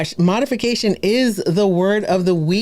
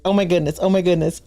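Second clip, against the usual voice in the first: ordinary talk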